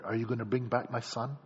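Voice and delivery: low, hushed question to God; prayerful plea. Acted. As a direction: ask it softly, like a mother praying. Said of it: "And you ask the Lord".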